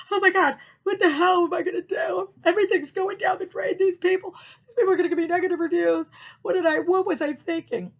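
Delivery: high, loud hammed-up meltdown; hysterical panic, retold. Seller dreading negative reviews, mock hysterical panic. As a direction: wail as if it's all collapsing. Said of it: "going crazy. And I'm just like".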